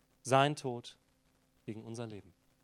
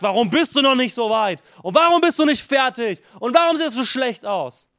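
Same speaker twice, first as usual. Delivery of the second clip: higher, louder scolding yell; angry yelling; 10 semitones higher, 14 dB louder than usual. Retold or imitated. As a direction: bellow like a harsh taskmaster. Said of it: "und dich anbrüllt und sagt".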